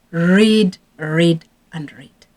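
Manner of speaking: each "read" slowed and falling, hammered home; slow emphatic repeat. Real, not acted. Telling parents to keep reading, slow and insistent. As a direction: drag out the repeated "read" like a closing point.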